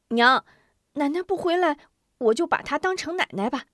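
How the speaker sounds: put-on high child voice; boy comforting his mother, tearful. A narrator's reading.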